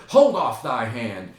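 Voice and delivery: loud acted command; forceful warning. Hamlet warns during the fight.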